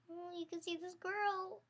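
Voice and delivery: ordinary delivery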